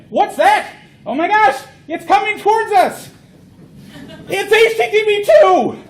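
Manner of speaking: high, loud mock panic at something coming; hammed-up fright at an incoming ship; mock alarm.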